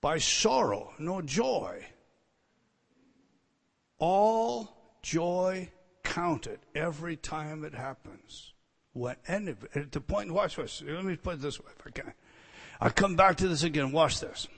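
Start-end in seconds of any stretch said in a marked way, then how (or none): 0.0-11.9 soft, hushed preaching; quiet preaching. Acted. Preacher to congregation, hushed and intimate. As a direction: lean in and preach it softly.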